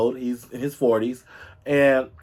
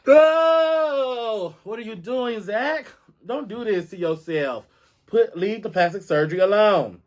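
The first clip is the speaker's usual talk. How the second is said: high, pleading outcry to Zac; a high dramatic plea; mock pleading; 6 semitones higher than usual. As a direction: beg him from the heart, hammed up.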